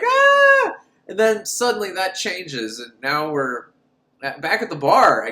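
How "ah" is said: very high, loud mock shriek; imitated terrified scream. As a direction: squeal in hammed-up fright. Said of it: "And he's like".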